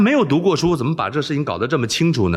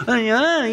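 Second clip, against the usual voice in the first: startled cry; 11 semitones higher than usual. Acted. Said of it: "一声".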